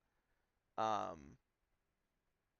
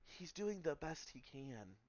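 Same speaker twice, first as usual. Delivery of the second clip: slow, gentle niece's voice; a hint of a young woman calming her uncle; soft reassuring niece; 3 semitones higher, 4 dB quieter, slower than usual. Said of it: "She's gonna, like, kinda look towards Jian".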